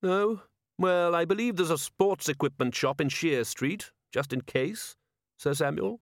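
high, lilting polite suggestion; innocently needling lord; urbane ruler, mock-helpful. A narrator's reading. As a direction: offer help with airy politeness. Vetinari voiced in story.

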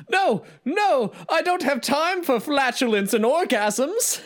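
high-pitched manic moon king; Robin Williams. Acted. Quoting the King of the Moon's favourite line, high and hammed-up. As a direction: protest far above your own voice in frantic caricature. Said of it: "my favorite line that he speaks".

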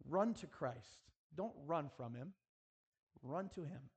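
ordinary talk, nothing marked

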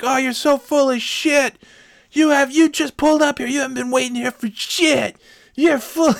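high, loud mock yell at the other driver; retold screaming match. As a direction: yell it back up high like a furious stranger. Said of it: "we just start screaming at each other".

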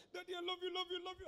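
put-on high little girl voice; sing-song it like a small child; talking doll. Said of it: "if you are a daddy like me".

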